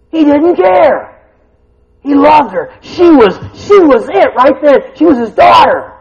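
loud, high, slow preaching; preaching at full force. Acted. Preacher to congregation, at full force. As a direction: hammer it home to the pews.

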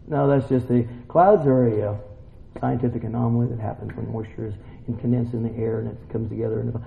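low, quick, quiet dismissal; dry sceptic. Acted. Sceptic explaining the cloud away, dry and dismissive. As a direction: rattle off the explanation under the breath.

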